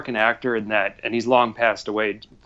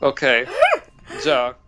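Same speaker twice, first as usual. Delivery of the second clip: grudging mock Russian accent, halting; lightly hammed Russian accent, words repeated; mad Russian; 3 semitones higher than usual. Shown as a demonstration. Teasing coworker with farm errands, mock Russian accent. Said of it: "sounding like this mad angry Russian".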